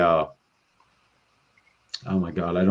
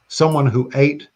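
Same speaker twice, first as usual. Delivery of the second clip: ordinary delivery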